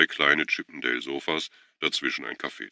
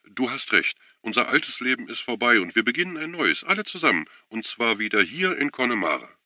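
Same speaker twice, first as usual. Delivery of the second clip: hectic, much higher and eager; hectic rush; 8 semitones higher than usual. A narrator's reading. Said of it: "redete hektisch drauf los". (hektisch drauflos geredet)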